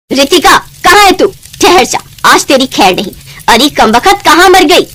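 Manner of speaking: high, loud furious yell; furious shout. Acted. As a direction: scream for the girl in a rage. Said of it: "Babita की गुस्से में भरी आवाज". (गुस्से में ऊँची चिल्लाती आवाज़)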